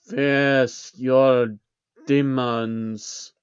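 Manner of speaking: distant, eerie demon voice; an otherworldly demon commanding him; demonic voice. Acted. Demon urging the narrator, eerie and distant.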